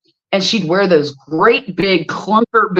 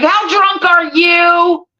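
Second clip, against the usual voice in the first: mock accusing; 9 semitones higher, slower than usual.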